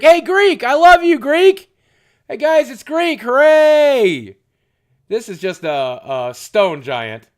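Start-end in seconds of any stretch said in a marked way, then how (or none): 0.0-4.4 loud, high, gushing cheer; excited shout-out. Real, not acted. Streamer shouting love to a chat member, loud and high. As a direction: yell the love across the room.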